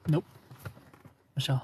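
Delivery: ordinary delivery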